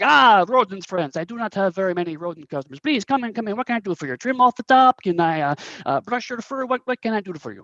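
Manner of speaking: high, effusive fake Korat accent; eager shopkeeper with a hammy Korat accent; Mister Meow. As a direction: welcome customers in a thick, bad Korat accent. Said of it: "very thick, um, Korat accent".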